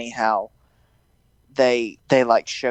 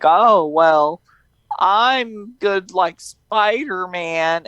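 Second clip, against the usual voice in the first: higher, drawn-out put-on voice; mock bashful hero; 7 semitones higher, much slower than usual. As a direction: play a coy Peter, slow and high. Voices bashful Peter replying.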